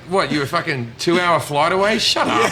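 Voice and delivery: high, loud sarcastic scoffing; mocking disbelief. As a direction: scoff at the award winner, pitch climbing.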